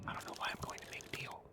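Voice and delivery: hushed unvoiced whisper; sneaky whisper to himself while plotting. Real, not acted. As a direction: whisper it like a secret plan. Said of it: "Why am I whispering?".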